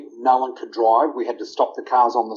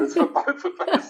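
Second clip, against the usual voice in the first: chuckling right through the words; laughing; 3 dB quieter than usual. Real, not acted. Telling his brother the bourbon is for both, laughing. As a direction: let a laugh break into the line.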